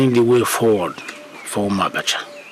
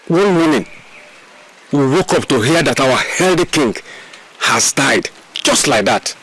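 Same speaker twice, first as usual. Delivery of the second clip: agitated; 6 semitones higher than usual. Acted. Chief tells elders of the deaths, agitated. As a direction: press the strange news.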